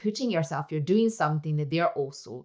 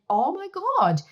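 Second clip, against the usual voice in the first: higher-pitched retold gasp of realization; retold shock; 12 semitones higher, 3 dB louder, slower than usual. Mother recalling her reaction to her son's remark, retold shock. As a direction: react with startled dismay, recounting it.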